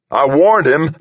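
high, loud defensive shout. A narrator's reading. Delaney shouts back at Harry's accusation, shouting. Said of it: "Delaney shouted".

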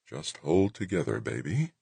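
put-on father coaxing a baby's first steps, softly; pleading coo. A narrator's reading. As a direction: coo to the machine like a baby. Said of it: "Wixon pleaded, almost cooed".

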